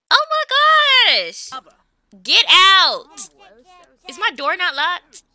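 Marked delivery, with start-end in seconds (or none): 0.1-3.3 high then loud; fed up, throw them out; exasperated shout. Real, not acted. Gamer yelling at Sims to get out, exasperated shout.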